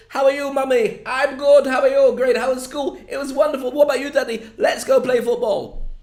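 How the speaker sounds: high, loud, fake-cheery family chat; hammed-up perky advert voices, all alike; model advert family. Model family greet each other in an advert, falsely chirpy.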